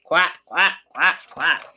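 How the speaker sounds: a big shy duck calling from afar; big duck quack. Acted. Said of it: "The big ducks say".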